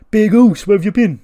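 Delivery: high, loud wrestler's greeting; excited hailing. Acted. [name] hailing Jacob as he walks in, loud and high. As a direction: call out big across the room.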